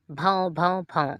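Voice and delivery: bow bow bow, imitating a dog; playful imitated barking; mock dog barks. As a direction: bark it out like a dog in a sack. Dog barking from inside sack.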